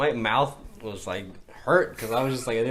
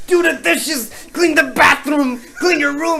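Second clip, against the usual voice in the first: high, loud mock shouting of chores; nagging mom; 11 dB louder than usual. Mom yelling chores as you walk in, loud and high. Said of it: "your mom's like".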